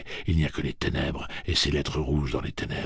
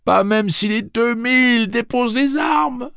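much higher, loud, laughing; laughing wildly; 10 dB louder than usual. Acted. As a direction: cackle through the defiance. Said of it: "riant plus fort que jamais".

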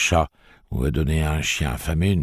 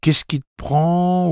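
mocking imitation; 14 semitones higher, 5 dB louder, slower than usual. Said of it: "Et c'était toujours".

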